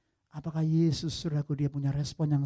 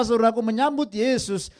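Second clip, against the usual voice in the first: normal talking voice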